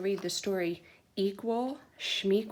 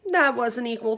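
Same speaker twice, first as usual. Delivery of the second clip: complaining; 4 semitones higher, 8 dB louder than usual. Acted. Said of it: "Turtle complained".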